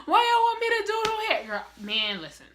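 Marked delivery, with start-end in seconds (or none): 0.1-1.5 very high, wailing whine; hammed-up high-pitched bawling; mock boo-hoo crying. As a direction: exaggerate a sobbing, babyish protest. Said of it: "boo-hoo crying".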